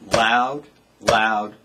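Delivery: LOUD punched on each beat. Shown as a demonstration. Drum teacher repeating dynamic contrast, firm and punched. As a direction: hit each "loud" hard, evenly spaced. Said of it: "So I can go loud".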